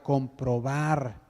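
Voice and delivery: comprobar drawn out very slowly, each syllable weighed; slow, emphatic comprobar; stressed word. Shown as a demonstration. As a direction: lean hard on comprobar and stretch it. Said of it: "y aquí remarco la palabra".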